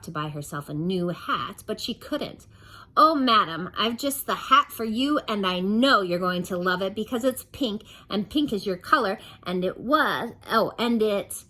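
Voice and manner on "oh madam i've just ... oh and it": louder, higher sales gush; gushing shop assistant. Acted. Assistant selling Miss Splendid a hat, gushing. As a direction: gush over the pink hat.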